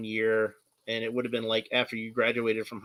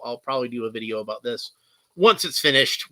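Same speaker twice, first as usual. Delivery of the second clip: ordinary talk, nothing marked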